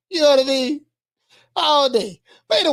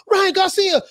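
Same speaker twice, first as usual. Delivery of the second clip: usual speaking voice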